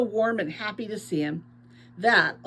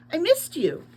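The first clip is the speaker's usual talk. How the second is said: higher, a voice unlike the narrator's own; Naya's eager voice; 7 semitones higher than usual. A narrator's reading. Naya greeting priest Waymack, eager and higher. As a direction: gush at him, pitched up.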